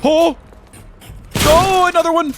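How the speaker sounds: sudden high alarmed yelp; startled shout. Real, not acted. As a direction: cry out at the sudden attack. Enemy appears, shouting.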